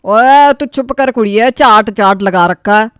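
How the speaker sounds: loud, cross telling-off; loud scolding, slowing at the end. Acted. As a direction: shut the kid down loudly. Elder scolds a girl.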